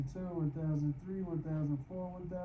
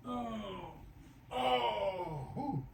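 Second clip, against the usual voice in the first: high, drawn-out moans, then a whoop of breath; stretch groan; 5 semitones higher, much slower than usual. Real, not acted. Exerciser feels the knee being stretched, groaning. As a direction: groan through the ache.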